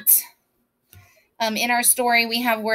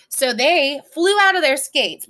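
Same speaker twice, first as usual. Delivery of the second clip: ordinary delivery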